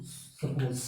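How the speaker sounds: ordinary talk, nothing marked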